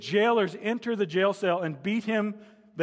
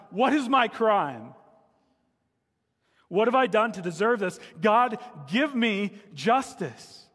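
higher, louder plea, slowing at the end; crying out; 5 semitones higher, 4 dB louder than usual. A narrator's reading. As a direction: call out to God, dragging out the last words. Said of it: "And Job cries out to God".

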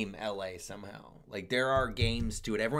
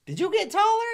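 much higher mocking voice; imitating KJ; 7 dB louder than usual. KJ needling his friend about height, high mock voice. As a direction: tease in a high put-on voice. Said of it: "he's like".

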